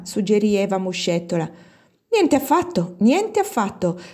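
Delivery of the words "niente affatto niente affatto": high, emphatic contradiction; mild storming protest. A narrator's reading. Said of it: "tempestò Anna Doria". (protesta veemente ma contenuta, acuta)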